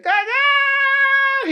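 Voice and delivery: put-on high, drawn-out little-kid echo; toddler parroting. Dad imitating his toddler son, high stretched squeal. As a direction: squeal it like a two-year-old copying dad. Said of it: "he's like".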